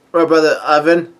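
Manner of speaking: ordinary delivery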